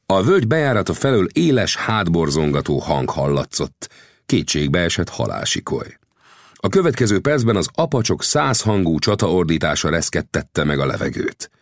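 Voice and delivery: plain reading voice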